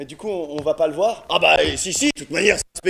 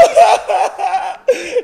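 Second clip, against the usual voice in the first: manic laugh; 9 semitones higher than usual. Acted. Mad laugh after death scene.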